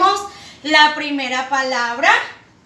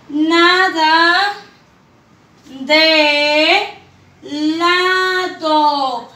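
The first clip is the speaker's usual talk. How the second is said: long pauses between words, each one stressed; "Tu" "pato" "nada" "de" "lado" spaced for children; much slower than usual. Shown as a demonstration. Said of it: "Dice la tercera oración".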